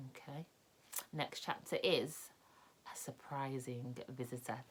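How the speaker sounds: softly whispered announcement. Real, not acted. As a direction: whisper the next chapter's title. Reader whispers chapter title.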